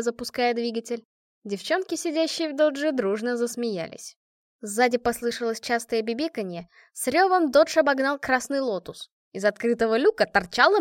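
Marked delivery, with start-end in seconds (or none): none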